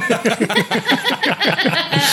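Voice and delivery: bursts of real laughter; laughing. Real, not acted. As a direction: cracks up laughing. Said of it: "please keep that".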